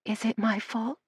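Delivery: small, hushed and hesitant; hesitant question. A narrator's reading. Frail girl timidly asks if it's her fault, hushed. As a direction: barely dare to ask it. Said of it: "before she could bring herself to ask".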